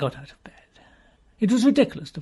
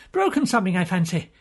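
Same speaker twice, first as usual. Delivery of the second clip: ordinary delivery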